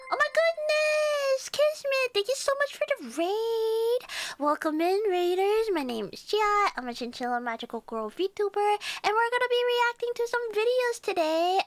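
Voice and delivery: high bubbly welcome for raiders; cute anime streamer. Acted. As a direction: squeal thanks like a magical girl.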